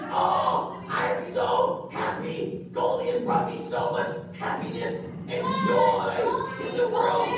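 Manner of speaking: put-on high, breathy kid gushing over his fish; a happy little boy in love with his goldfish; high puppet kid, slowed, gushing; childlike puppet voice. Gus character voiced by puppet.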